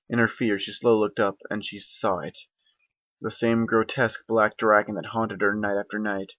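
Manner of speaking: ordinary delivery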